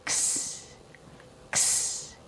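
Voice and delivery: "x" as a voiceless hissed ks; ks sound. Shown as a demonstration. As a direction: breathe out k-s with no voice. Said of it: "pronounced with a K S".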